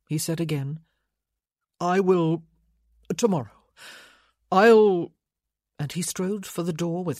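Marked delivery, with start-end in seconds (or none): 1.8-5.1 loud, broken-off promise; distraught. A narrator's reading. Son puts off the visit before fleeing, distraught. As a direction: force it out in bursts, breaking off.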